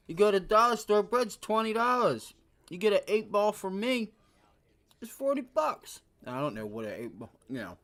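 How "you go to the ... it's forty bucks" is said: dealer pitch. Acted. Plays a drug dealer pitching.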